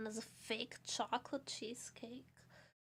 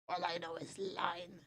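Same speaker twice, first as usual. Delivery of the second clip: dazed; 8 semitones lower, 5 dB quieter than usual. Pretend. Repeating in exhausted daze.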